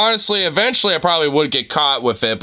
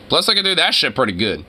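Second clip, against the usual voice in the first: normal talking voice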